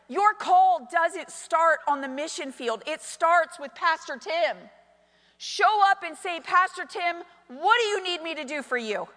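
loud, high, slow preacher's proclamation; full-force sermon delivery; preaching. Acted. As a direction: drive each phrase home loudly, stretching the key words.

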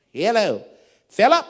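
loud, high, questioning phone hail; caller on the phone. Retold or imitated. Senior pastor phoning Philip, high loud hail. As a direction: call out high and loud down the line. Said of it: "I get a phone call".

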